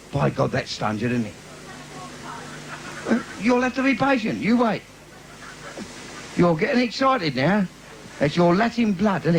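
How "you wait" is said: normal talking voice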